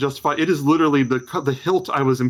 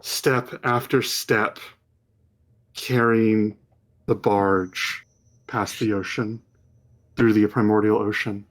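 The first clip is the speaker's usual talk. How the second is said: slow, hushed scene setting; measured storyteller voice; game master's vision narration; 2 semitones lower, slower than usual. Acted. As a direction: draw them into the vision.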